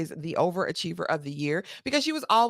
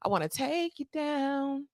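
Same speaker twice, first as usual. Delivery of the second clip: sultry come-on; 8 semitones higher, slower than usual. Retold or imitated. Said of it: "And she's like".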